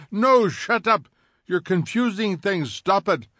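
high, loud desperate outburst; yell. Acted. Montag overwhelmed by Beatty's words, shouting. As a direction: cry out to make it stop. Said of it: "He wanted to yell".

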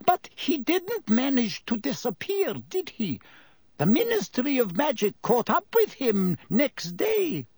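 high-pitched woman's voice, pleased; satisfied. A narrator's reading. Landlady challenging the tale of his escape, high and satisfied. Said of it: "said with some satisfaction".

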